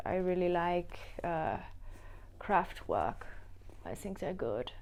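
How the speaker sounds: soft, mock German accent; German accent impression. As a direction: play a quiet German music fan, accent on. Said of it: "Whenever I do an impression".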